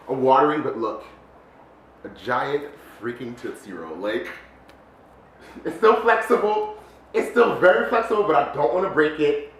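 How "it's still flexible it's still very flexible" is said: usual speaking voice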